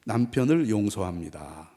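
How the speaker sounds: slow, measured declaration; drawn-out lead-and-repeat line; lead for repetition. Shown as a demonstration. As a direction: say it slowly for the wives to repeat. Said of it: "여자들 먼저 따라서 합시죠".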